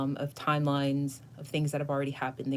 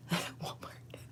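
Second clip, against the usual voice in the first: whispered joke; a sly whispered joke; 9 dB quieter than usual. Real, not acted. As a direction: hush the punchline. Joking aside.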